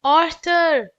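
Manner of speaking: loud, high call of a name; yell. Acted. Stanley calls his brother for help, loud yell. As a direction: shout Arthur's name across the hill. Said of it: "yelled Stanley".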